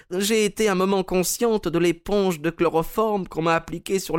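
put-on high, sad old lady voice; a frail lady, smiling sadly; Mrs Maberley. A narrator's reading. Mme Maberley à son conseiller Holmes, voix aiguë et triste.

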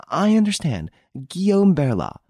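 the lady agreeing to the plan; eager accomplice; conspiring lady. Acted. Narrator voices the lady.

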